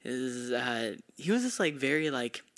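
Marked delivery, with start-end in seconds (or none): none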